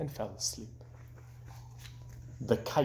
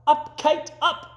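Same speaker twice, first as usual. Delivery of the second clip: high, loud shout to the kite; 13 semitones higher, 4 dB louder than usual. Acted. Frog showing Toad how to shout at the kite, high and loud. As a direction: shout it up into the sky.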